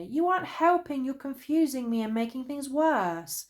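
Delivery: high, very loud furious yell; angry shout. A narrator's reading. Bear, confused by Fox, yells high and loud in anger. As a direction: explode at Fox. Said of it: "shouted Bear angrily".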